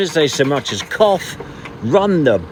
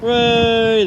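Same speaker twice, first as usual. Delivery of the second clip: cheer; 11 semitones higher, 6 dB louder than usual. Real, not acted. Car rolls down perfectly.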